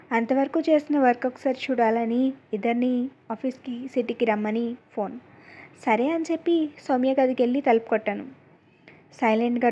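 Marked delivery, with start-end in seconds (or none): none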